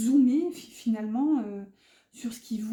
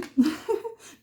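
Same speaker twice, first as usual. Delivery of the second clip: short, higher-pitched laugh; amused laugh; 5 semitones higher, 3 dB louder than usual. Real, not acted. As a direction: laugh off your own small slip. Laughs at own upside-down cards. (petit rire amusé)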